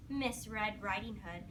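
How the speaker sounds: ordinary talk, nothing marked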